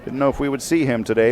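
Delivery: ordinary talk, nothing marked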